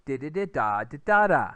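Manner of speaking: sing-song rhythm syllables, last one loud; rhythm in nonsense syllables. Shown as a demonstration. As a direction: tap out the rhythm as di and da. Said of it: "you can see how".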